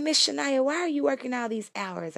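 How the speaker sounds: high childlike voice, lightly played; voicing the kids. Kids she works for asking Miss Shania why she works so much, in a high voice. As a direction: pitch it up like a curious kid. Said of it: "they said".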